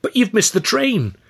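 high, loud fussing; flustered friend. A narrator's reading. Stan flustered that Arthur will miss his boat, high fussing.

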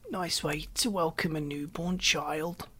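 put-on soft, slow, feeble old man; weak complaint. A narrator's reading. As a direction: grumble weakly, as if worn out. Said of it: "he complained in a weak voice".

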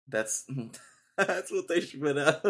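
laughing through the words. Real, not acted. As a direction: speak while laughing. Laughing at own joke.

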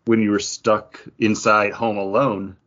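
usual speaking voice

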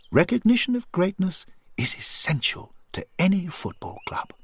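honour a friend with measured, swooping emphasis; earnest, encouraging companion. A narrator's reading. Voices Henry's tribute to Johnny.

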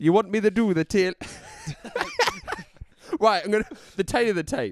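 laughing. Real, not acted. Jokey catchphrase intro, laughing.

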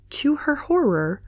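ordinary delivery, nothing marked

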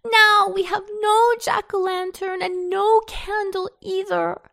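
put-on high, whining child's lament; hammed-up crying little sister; mournful wail. A narrator's reading. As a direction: weep it out in a high voice. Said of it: "she mourned".

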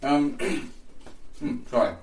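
cheeks stuffed full; talking with a mouthful of food. Real, not acted. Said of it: "Mouthful of food".

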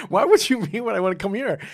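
high, disbelieving retort; as if the question were absurd; incredulous. Retold or imitated.

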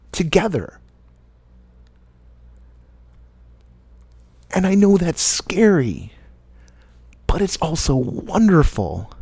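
rally them warmly and slowly; inspirational speech. Acted.

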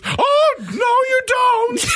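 very high slow shout; mock shout. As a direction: yell it out high and drawn. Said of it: "he just goes".

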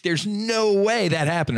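high-pitched incredulous protest; retold disbelief. Man retelling his reply to friends, incredulous. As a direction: flatly refuse to believe it, pitch jumping up.

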